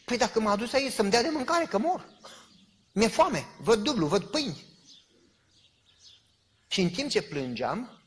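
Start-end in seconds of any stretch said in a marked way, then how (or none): 0.1-4.7 raised, higher voice, crying out to God; desperate hungry plea. Retold or imitated. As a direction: beg loudly, starving and desperate. (strigăt disperat, flămând)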